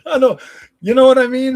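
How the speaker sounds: laughing loudly through the words. Real, not acted. Married streamer laughing off being fat, loud laughing. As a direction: let a loud laugh carry the line. Laughs while talking about weight.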